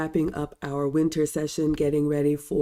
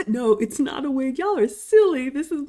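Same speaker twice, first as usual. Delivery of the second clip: high laughing protest, much louder; laughing denial; 9 semitones higher, 5 dB louder than usual. Real, not acted. A host laughing off viewers' wig questions, high and giggly.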